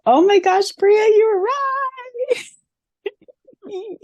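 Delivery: usual speaking voice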